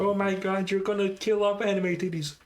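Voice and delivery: high, loud, laughing exclamation; laughing outburst. Real, not acted. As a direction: crack up while protesting. Player cracks up at a joke.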